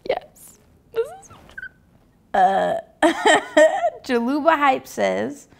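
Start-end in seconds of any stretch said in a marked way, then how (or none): 0.1-1.7 tiny, hushed high squeak; mock fan in a small, quiet voice; imitated agreeing fan. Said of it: "and they're like". 3.0-4.0 short, high giggle; real quick laugh rising in pitch; amused laugh. Real, not acted. Laughs at the comments.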